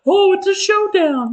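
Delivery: very high, loud hammed-up drama; mocking dramatic gasp. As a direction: ham up a soap showdown. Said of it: "she attacks her".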